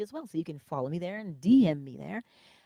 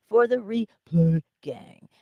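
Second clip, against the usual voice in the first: slow, higher and louder, stretching "replay"; playfully drawn-out; 4 semitones higher, 7 dB louder, slower than usual. Real, not acted. Host hailing later viewers after a laugh, drawn-out shout-out. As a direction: drag out "replay" for fun.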